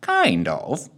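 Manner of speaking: understated, knowing answer; cryptic reply. Acted. Marley answering Santa before firing, mildly cryptic. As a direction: answer with a touch of mystery. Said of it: "replied Marley cryptically".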